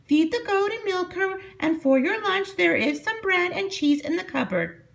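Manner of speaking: put-on high old witch voice; Strega Nona voice. A narrator's reading. Strega Nona instructs her new helper, high old witch voice. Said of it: "Strega Nona said to Big Anthony".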